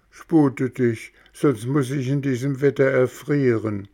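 high, eager puppet voice; a small puppet insisting on who he is; puppet boy voice. Acted. Bengele drängt im Regen. (hohe, eifrige Hampelmannstimme)

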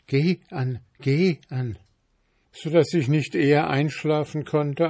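a hint of an uneasy young maid; frightened maid. A narrator's reading. Maid tells her night.

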